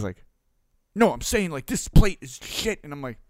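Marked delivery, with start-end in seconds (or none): 0.9-2.8 loud, very high imitation of exasperated anger; fired-up customer. Customer insisting to apologetic owner, mock furious. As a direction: hammer the complaint, high and loud, slowing down. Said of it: "he's fired up".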